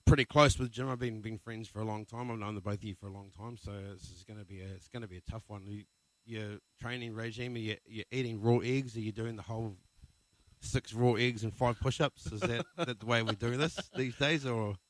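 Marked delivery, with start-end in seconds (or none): none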